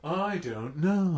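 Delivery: slow, drawn-out affected voice; Lisa's voice. Retold or imitated. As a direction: echo it with long swooping vowels. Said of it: "she talked just like that".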